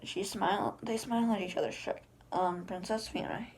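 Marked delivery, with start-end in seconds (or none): none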